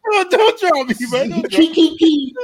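mock pleading. Pleading not to be dropped.

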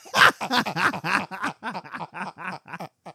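long, loud cackling laugh; big laugh. Real, not acted. Comic breaks up at own impression, long real laugh. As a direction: crack up in repeated bursts.

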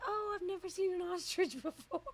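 high, delighted gasp of wonder; excited. Retold or imitated. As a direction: squeal it like a thrilled tourist. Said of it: "And I was like".

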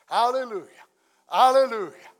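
rising joyful shouts of praise; joyful hallelujah. Retold or imitated. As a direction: call out hallelujah like the heavenly host. Acting out heaven's praise.

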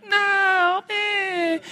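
put-on high, sobbing toddler refusal that breaks into a whimper; wailing toddler no. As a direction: imitate a two-year-old crying no. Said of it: "And he said".

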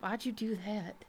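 put-on softly spoken Southern accent. Woman calls across the field at the dummies, Southern. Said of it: "Why are you Southern now?".

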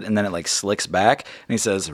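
ordinary talk, nothing marked

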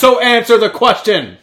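loud, high demand for an answer; mock demand. Host pressing his co-host for an answer, loud and mock-insistent. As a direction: bark it out, playfully insistent.

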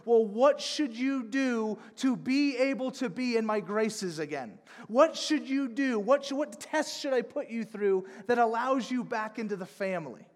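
a hint of a demanding father; mock stern questioning; testing father. Imagined father setting conditions.

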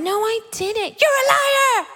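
put-on high indignant kid, rising into a cry; accusing child. Child answering her sibling's accusation, high indignant retort. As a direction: play a kid denying it, pitch climbing.

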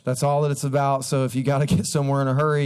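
ordinary talk, nothing marked

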